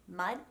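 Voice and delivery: mud with a gentle D at the end; tongue flattened for the D closing mud; soft final D. Shown as a demonstration. Coach repeats example, soft D ending mud. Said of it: "a bit of a softer sound".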